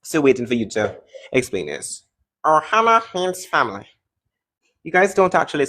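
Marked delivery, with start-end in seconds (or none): 2.4-3.8 high, mocking cartoon creature voice; exaggerated put-on alien voice; Stitch impression. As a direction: mimic a cartoon alien to tease. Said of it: "you sound like Stitch".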